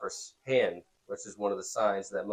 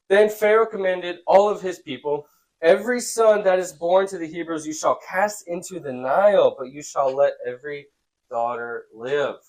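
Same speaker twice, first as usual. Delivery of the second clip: raised, projected read-aloud; scripture reading; 5 semitones higher, 6 dB louder than usual. A narrator's reading. Teacher reads Pharaoh's command to students, projected reading. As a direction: read the decree out to the room.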